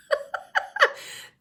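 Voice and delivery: high, wheezy burst of laughter; real laughter. Real, not acted. Vlogger recalling being stuck in her bra, wheezing laugh. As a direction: dissolve into helpless giggles.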